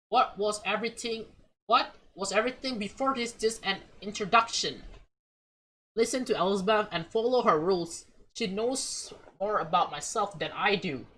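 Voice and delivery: ordinary delivery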